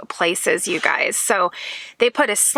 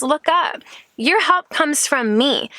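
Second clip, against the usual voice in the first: getting emotional; 6 semitones higher than usual. Real, not acted. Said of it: "Oh, I'm getting emotional".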